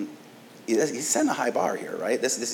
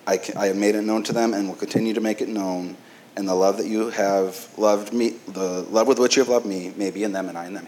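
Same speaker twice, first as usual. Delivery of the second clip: louder, measured reading voice; scripture reading; 3 dB louder than usual. Acted. Pastor reading Jesus' prayer to congregation, formal scripture reading. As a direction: read the verse to the congregation.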